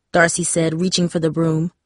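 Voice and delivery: ordinary delivery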